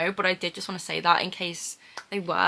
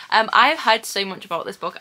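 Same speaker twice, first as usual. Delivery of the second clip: ordinary talk, nothing marked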